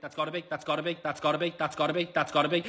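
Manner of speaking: low, hushed repetition, building slightly in volume; tense muttering. Pretend. Player willing an attempt to work, hushed muttering. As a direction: mutter it quickly under the breath, hoping.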